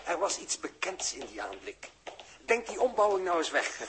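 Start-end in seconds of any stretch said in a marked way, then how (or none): 2.5-3.9 urgent prodding. Acted. Urging a puzzled companion.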